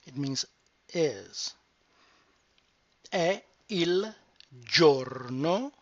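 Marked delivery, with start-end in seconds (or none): 3.1-5.7 "È il giorno" slow and separated; slow, syllable by syllable. Shown as a demonstration. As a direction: break "È il giorno" into slow units. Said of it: "break down the word".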